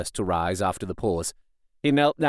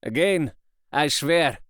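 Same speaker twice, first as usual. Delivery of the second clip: lightly voiced resonant vow; a hint of a ringing oath; resonant professor's voice; 4 semitones higher than usual. A narrator's reading. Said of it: "the professor's resonant voice".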